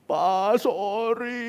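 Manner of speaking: high, drawn-out imitation of a crying child; mock sobbing apology. Small son apologising to his father, mock-tearful and high. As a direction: imitate a tearful little one, voice rising. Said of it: "sampai sesenggukan".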